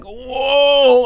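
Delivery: high, loud starstruck whoa; excited fanboy whoa. Retold or imitated. Fan spotting Sam Elliott in a studio, excited high whoa. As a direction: gasp it out like a giddy fan. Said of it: "I was so excited and such a fanboy".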